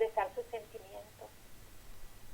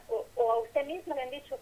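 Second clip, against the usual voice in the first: ordinary delivery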